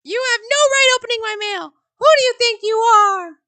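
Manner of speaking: put-on high, loud old mother snapping at her son; indignant mother. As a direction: scold him for opening her mail. Mother scolding son over mail.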